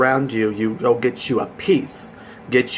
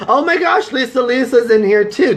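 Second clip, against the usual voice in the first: much higher and louder, thrilled to see Lisa; excited squeal; 12 semitones higher, 5 dB louder than usual. Real, not acted. Greeting a friend who joined her live, excited squeal. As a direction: squeal it, delighted surprise.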